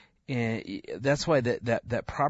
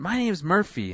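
put-on child announcing herself; 9 semitones higher, 4 dB louder than usual.

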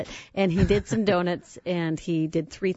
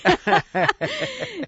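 laugh; 4 dB louder than usual. Real, not acted. Laughing at son's quote.

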